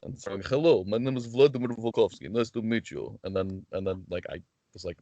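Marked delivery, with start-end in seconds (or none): 0.4-3.2 put-on Russian accent; thick-tongued Russian character voice. Shown as a demonstration. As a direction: introduce yourself as a Russian wrestling character. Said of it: "voice work, so I do characters".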